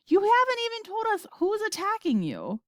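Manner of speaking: high, exasperated demand; yelling. A narrator's reading. President demanding details from astronauts, yelling. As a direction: raise the pitch and demand an answer. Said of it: "yelled the president".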